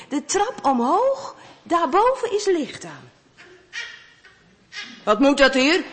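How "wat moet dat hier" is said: a hint of a grumpy man, loud; grumpy neighbour snapping at the door, mildly gruff. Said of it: "zegt die man".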